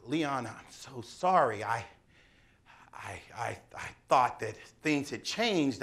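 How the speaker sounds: an ashamed confession breaking to a whisper; hushed apology. Retold or imitated.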